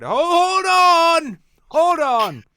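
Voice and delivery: put-on high, loud, slow crotchety old man. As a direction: shout it like a deaf old man. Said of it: "put an old man voice on".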